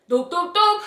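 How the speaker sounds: three short, even dialing sounds; spoken beep-beep-beep; imitated phone beeps. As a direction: mimic pressing phone keys. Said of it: "I picked up the phone".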